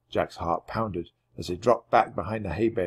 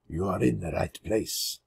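lightly lowered man's voice; a hint of a calm Frenchman; Gaston's voice; 3 semitones lower, 6 dB quieter than usual. A narrator's reading.

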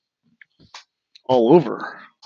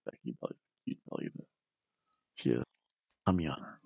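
very soft, quick whisper; hushed playful whisper; whispered aside; 12 semitones lower, 21 dB quieter, much faster than usual. Real, not acted. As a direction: whisper it fast, as a private little aside. Playful aside about belly.

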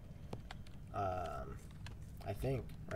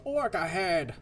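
higher mock yell of warning; put-on shouted warning; imitated character yell; 5 semitones higher, slower than usual. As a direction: call out like a game character sounding the alarm. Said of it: "your character will yell out".

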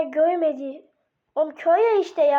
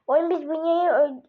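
ordinary delivery

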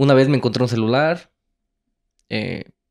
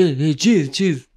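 a hint of a confused foreign seller; lightly voiced vendor; 4 semitones higher, 3 dB louder, much slower than usual. Narrator imitates the Egyptian vendor repeating cheese, lightly voiced. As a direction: repeat it like you half understand.

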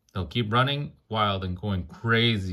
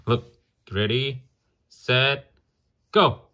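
excited countdown; 4 semitones higher, 5 dB louder, slower than usual. Acted. Cheering a race in story.

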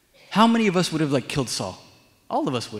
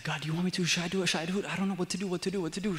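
hushed, higher-pitched nervous babble; nervous dithering; 4 semitones higher, 7 dB quieter, much faster than usual. Retold or imitated. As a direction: imitate someone panicking under their breath, words tumbling in repeats. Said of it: "he's very nervous".

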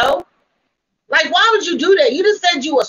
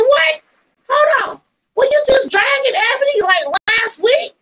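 shocked disbelief; 11 semitones higher than usual. Retold or imitated. Retelling her own shocked reaction.

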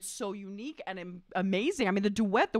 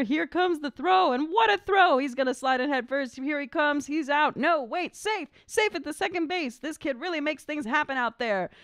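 rising, excited sportscaster call, higher at the big plays; 4 semitones higher than usual. Acted. Reading the baseball call to viewers, excited play-by-play. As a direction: call the game like a radio announcer on the edge of a close play.

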